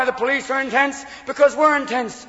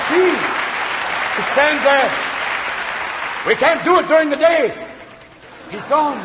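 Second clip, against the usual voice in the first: spooky henchman warning in a raised voice; Bela Lugosi; 5 semitones higher than usual. Acted. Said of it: "looking a lot like Bela Lugosi".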